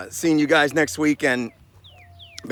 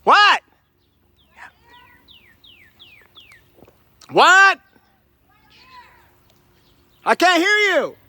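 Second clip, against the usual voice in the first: high, loud calls across distance; calling out; 6 dB louder, slower than usual. Pretend. Hiker shouting to an unseen person, loud high calls. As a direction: shout to someone far off.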